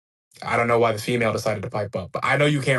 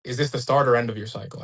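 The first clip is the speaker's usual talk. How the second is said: usual speaking voice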